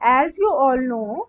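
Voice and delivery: stretched out word by word; very slow model answer; slow dictation. Shown as a demonstration. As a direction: model the phrase slowly for students to write. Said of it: "इसको English में कैसे बनाएंगे?".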